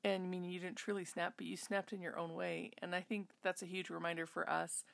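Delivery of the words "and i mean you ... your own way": ordinary delivery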